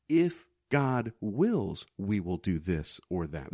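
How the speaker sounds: very slow, drawn out; slow humble words. Shown as a demonstration. Preacher modelling how we should speak of plans. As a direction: say it slowly, with humility.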